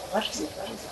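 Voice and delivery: asking herself quietly, trailing off; fading self-question. Retold or imitated. A child racking her brain, muttering softly.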